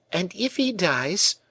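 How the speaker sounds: high, slow and merciless; pitiless tormentor. A narrator's reading. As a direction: lay the guilt on her slowly, without pity. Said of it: "continued her pitiless tormentor".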